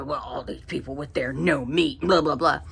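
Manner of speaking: low, lightly dismissive mock voice; a hint of a grumbling coworker. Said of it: "And he goes".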